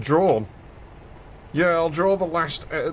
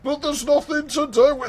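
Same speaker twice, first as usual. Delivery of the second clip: put-on whiny kid; 12 semitones higher than usual. Retold kid whining at mum.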